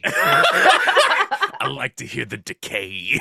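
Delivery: slow, loud raspy undead croak; ancient lich voice. Pretend. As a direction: drag it out like a rotting lich. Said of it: "I like it when it's all raspy".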